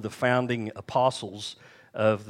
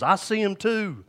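put-on high, slow old lady voice, much higher and louder; little old lady; 10 semitones higher, 6 dB louder, slower than usual. Old lady in back row telling the preacher, high and slow. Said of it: "and she goes".

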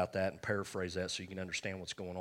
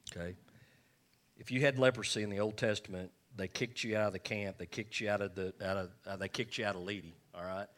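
usual speaking voice

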